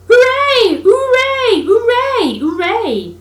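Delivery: high, loud cheer; joyful shout. A narrator's reading. Rat shouting for joy at his find in the snow. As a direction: whoop with triumph. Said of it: "Suddenly the Rat cried".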